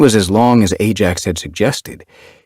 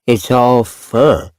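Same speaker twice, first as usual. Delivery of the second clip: high, slow, let-down voice; disappointed kitten; 5 semitones higher, 4 dB louder, much slower than usual. A narrator's reading. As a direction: say it with a mouth full, let down. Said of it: "He sounded disappointed".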